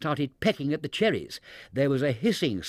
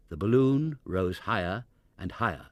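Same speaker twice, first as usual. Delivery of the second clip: ordinary delivery, nothing marked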